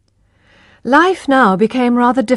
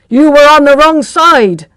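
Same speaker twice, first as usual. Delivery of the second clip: louder, brusque man's voice; a hurried driver calling out; gruff post driver; 7 semitones higher, 9 dB louder than usual. Acted. Post driver telling Tess off after the crash, louder and brusque.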